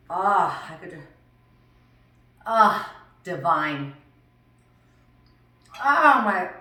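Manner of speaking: loud, drawn-out sighs of pleasure; savoring delight. Real, not acted. Cook tasting her salmon for viewers, savoring delight.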